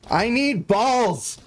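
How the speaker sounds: stretched-out theatrical cry for help; mock desperate shout. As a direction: plead to the chat like a starving beggar. Begging chat for balls.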